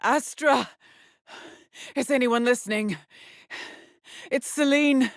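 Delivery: high-pitched anxious plea; desperate calling out. Acted. Trapped woman calling for anyone listening, desperate. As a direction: call out for any answer, scared.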